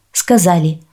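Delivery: plain reading voice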